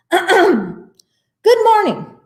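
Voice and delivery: high, loud chief-frog greeting; projected, high-pitched formal welcome; speaking up. A narrator's reading. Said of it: "spoke up".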